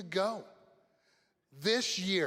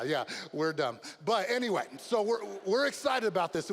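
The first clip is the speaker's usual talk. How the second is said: usual speaking voice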